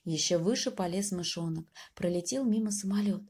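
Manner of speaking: plain reading voice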